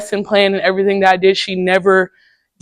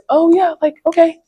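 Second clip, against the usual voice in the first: much higher, light put-on agreeable voice; code-switched voice; 9 semitones higher than usual.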